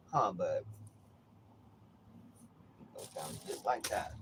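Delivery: normal talking voice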